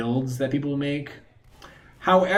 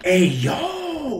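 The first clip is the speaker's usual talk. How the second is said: friend's hype shout; 12 semitones higher than usual. Retold or imitated. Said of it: "But he would go like".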